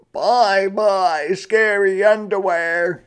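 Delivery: put-on high, loud child's goodbye; gleeful little rabbit. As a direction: send it off with triumph. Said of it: "he said".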